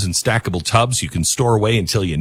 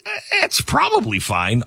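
high mock shrug; 11 semitones higher, 4 dB quieter than usual. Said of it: "Don't go with".

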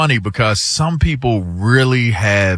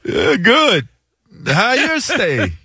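mock Jamaican accent, high and slow; imitating a Jamaican accent; 9 semitones higher, slower than usual. Pilot's greeting retold, high slow mock Jamaican accent. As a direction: stretch it out in a put-on Jamaican voice, pitched up.

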